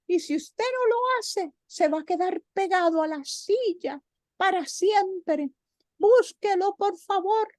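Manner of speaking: high, urgent cricket voice begging the knight; pleading cricket. Acted. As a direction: squeak out a desperate plea.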